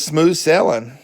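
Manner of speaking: Southern US "sailing" said as "sellin'"; southern accent. Real, not acted. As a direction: say SAILING the Southern way, "SELL-un", dropping the g. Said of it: "Pardon my southern accent".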